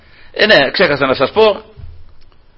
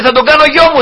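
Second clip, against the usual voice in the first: much higher, joyful declaration; delighted princess; 11 semitones higher, 3 dB louder than usual. Retold or imitated. The princess declaring she will adopt Moses, high and joyful. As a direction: announce it with delight. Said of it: "λέει".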